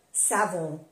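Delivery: savon ending in a nasal on; French pronunciation. Shown as a demonstration. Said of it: "O N se pronuncia on". (savon con la on nasal)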